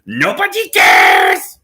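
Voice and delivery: low, slow parrot squawk, shouted; a deep bird-shout dragged out slowly. Retold or imitated. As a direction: bellow it like a rude parrot. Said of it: "if I use this coping mechanism".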